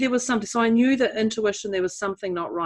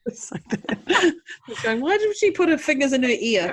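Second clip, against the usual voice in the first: broad Australian Bogan accent, hammed up; mock Bogan accent; 6 semitones higher than usual. Bogan accent, joking about ear versus air. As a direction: put on a broad Bogan twang. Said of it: "It's my Bogan accent".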